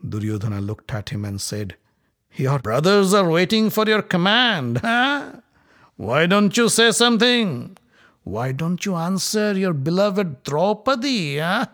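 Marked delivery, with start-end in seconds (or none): 2.3-11.6 higher, louder jeering questions; taunting. Acted. Duryodhana taunting the silent Yudhishthira, jeering. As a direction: needle him with scorn.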